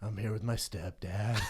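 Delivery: low sheepish line through a laugh; mock shy guy. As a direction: mumble it like an embarrassed man. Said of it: "He goes".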